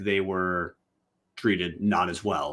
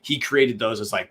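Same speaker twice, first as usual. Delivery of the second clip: ordinary talk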